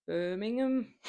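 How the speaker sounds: a clumsy stab at a Brummie Birmingham; half-hearted Brummie accent; attempted Brummie. Shown as a demonstration. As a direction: try to say Birmingham like a Birmingham local. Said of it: "I'm so bad at accents".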